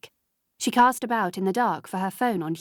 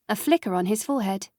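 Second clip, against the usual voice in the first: ordinary delivery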